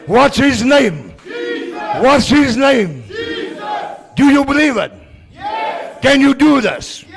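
high, loud call and response to the crowd; rally the crowd, shout every word; shouted preaching. Acted.

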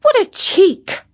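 high indignant cry; outraged little gasp of a line. Acted. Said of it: "said Katy".

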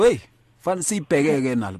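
normal talking voice

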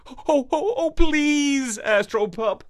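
mild panting plea; pleading pant. A narrator's reading. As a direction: beg like a panting dog. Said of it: "he panted".

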